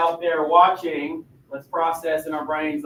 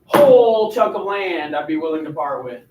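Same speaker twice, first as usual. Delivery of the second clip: projected line demo; 6 dB louder than usual. Shown as a demonstration. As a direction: belt out "Whole" and stretch it. Director shows how to deliver line.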